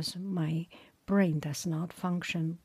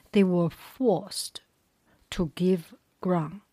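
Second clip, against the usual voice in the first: loud, emphatic epic read-aloud; story narration; 6 dB louder than usual. A narrator's reading. Reader telling the Trojans' sudden charge, emphatic story narration. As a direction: drive each battle phrase out with force, pausing between.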